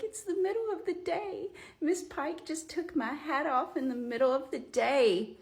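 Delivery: high-pitched imitation of a distraught friend; mock-tearful, high protest; retold tearful upset. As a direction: whine it out like a freaked-out, crying friend. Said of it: "starts crying. And she's like".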